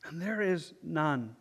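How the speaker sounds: slow, solemn psalm reading with long pauses; slow scripture reading. Real, not acted. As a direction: read slowly, leaving space to think it through. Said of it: "Try to read it slowly".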